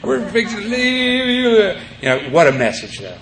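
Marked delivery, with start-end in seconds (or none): 0.0-1.7 high, slow teasing singsong; mock taunt. Speaker mocking the crying convicts in prison, teasing singsong. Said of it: "It was like".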